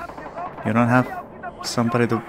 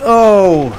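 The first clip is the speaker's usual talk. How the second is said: startled; 7 semitones higher, 11 dB louder than usual. Real, not acted. Killed, hit in the face.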